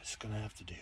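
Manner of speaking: a soft, resigned whisper to himself. Real, not acted. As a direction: breathe it out, giving up a little. Resigned aside after a sigh.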